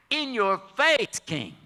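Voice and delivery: defiant taunt. Acted. Boy confronts the king.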